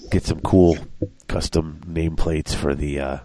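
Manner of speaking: ordinary talk, nothing marked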